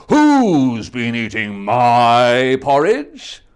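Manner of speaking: slow, loud, big bear voice; Papa Bear. Acted. Papa Bear discovering his porridge, slow booming. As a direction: boom it out slowly like a big bear. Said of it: "Papa Bear said".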